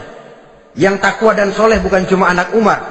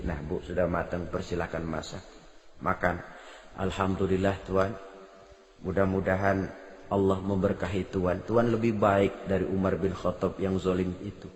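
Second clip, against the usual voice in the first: 1 hushed retelling; 12 semitones lower, 16 dB quieter than usual. Retold or imitated. Quiet voiced dialogue.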